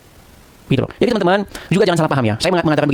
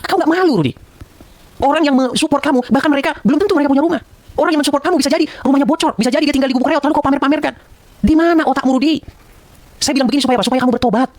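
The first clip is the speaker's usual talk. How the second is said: raised, indignant rebuke; angry scolding; 8 semitones higher than usual. Real, not acted. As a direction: rebuke him, voice pitched up high. Scolding Rudi in public rant. (teguran marah dengan nada tinggi)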